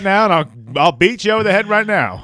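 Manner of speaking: hammed-up threat, rising high and loud; mock outrage. Host threatens the co-host who mocked his accent, mock outrage. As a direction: act offended and threaten a beating.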